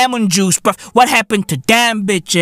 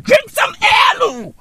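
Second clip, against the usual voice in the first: high shout; 12 semitones higher than usual. Acted. Ranting advice at dieters.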